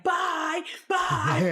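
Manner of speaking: high shouted bye bye; mock bye shout. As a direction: wave them off loudly and high. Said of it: "The bartender in the back, like".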